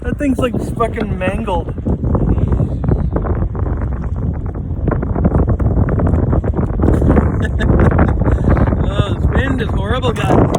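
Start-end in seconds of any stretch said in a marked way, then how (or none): 1.8-8.9 long breathy burst of laughter; loud laugh. Real, not acted. Laughing at the mangled sandwich.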